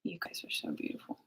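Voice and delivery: soft breathy whisper to the viewers. Real, not acted. Whispering to her live viewers. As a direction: whisper it fondly to the chat.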